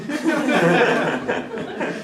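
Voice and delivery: big laugh, rising high; high burst of laughter. Real, not acted. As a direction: laugh out loud at the joke. Laughs at own joke.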